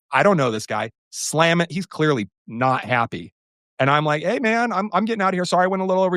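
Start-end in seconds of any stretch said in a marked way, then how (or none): 4.2-6.2 sheepish apology. Retold or imitated. Retold apology to stranger.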